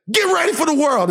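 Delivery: high, forceful fatherly command; toxic dad. Acted. Dad toughens up crying son, stern. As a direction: imitate a hard-line dad, pitch jumping up. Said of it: "you know what he would say to me".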